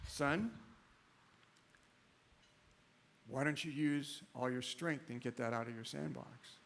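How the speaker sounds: calm father. Acted. Father speaks calmly to frustrated son.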